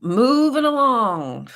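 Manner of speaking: drawn-out, high announcer call; sing-song announcer. Pretend. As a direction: stretch it out like a host. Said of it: "let's move along then".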